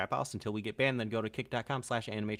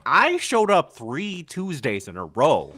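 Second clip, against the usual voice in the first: loud, slow indignant complaint; whiny complaining fan; 4 semitones higher, 10 dB louder, slower than usual. Retold or imitated. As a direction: play an offended fan. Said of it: "someone will be like".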